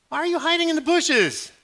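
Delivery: high, loud, puzzled question; God asking. Acted. God asking Adam why he hides, high and loud. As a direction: ask like a baffled father.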